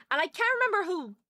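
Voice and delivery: usual speaking voice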